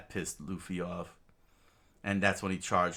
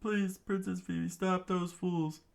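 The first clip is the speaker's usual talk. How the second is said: pleading servant; 12 semitones higher than usual. Acted. Old man begging the princess to stop the rebels, high pleading voice. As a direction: beg up high in a borrowed voice. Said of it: "oh, wait, that's Toto?".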